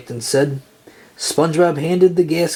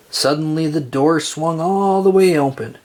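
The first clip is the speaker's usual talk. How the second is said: ordinary delivery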